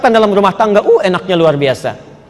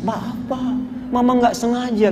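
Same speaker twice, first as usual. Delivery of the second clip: softer mock-tearful wife; menangis; 3 semitones higher, 8 dB quieter than usual. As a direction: plead softly as if crying, lightly acted. (istri pura-pura menangis, lirih)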